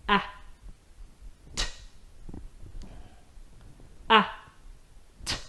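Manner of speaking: ah, then t, said apart; sounding out words. Shown as a demonstration. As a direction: split into single slow sounds. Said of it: "sound it out slowly".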